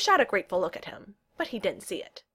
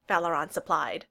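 plain reading voice

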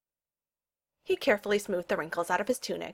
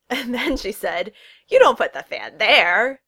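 louder, drawn-out punchline; chortling storytelling; 3 semitones higher, 10 dB louder, slower than usual. A narrator's reading. Friend finishes a funny story, chortling with amusement. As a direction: land the joke with relish. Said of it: "Valeron chortled".

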